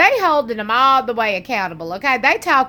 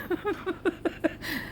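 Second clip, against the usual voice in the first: airy little laugh, unvoiced; breathy giggle; 7 semitones higher, 11 dB quieter than usual. Real, not acted. Vlogger giggling at her own teasing remark, breathy. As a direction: let out a quiet breathy giggle.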